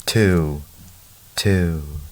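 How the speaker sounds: spaced-out echo repeats, each falling away. A narrator's reading. Father's voice echoed in the canyon, imitated echo. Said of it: "His voice echoed".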